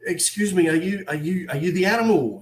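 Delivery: high-pitched, eager query to a celebrity; imitated mum. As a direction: put on mum approaching a star. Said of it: "And she goes, I said to him".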